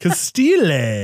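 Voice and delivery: "Castile" drawn out slowly as kah-STEEE-layyy; Latin version. Shown as a demonstration. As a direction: stretch "Castile" into three syllables ending in lay. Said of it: "let's go with the Latin version".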